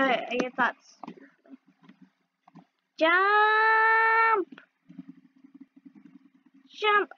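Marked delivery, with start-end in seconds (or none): 3.0-7.1 shout. Real, not acted. Player yells at game.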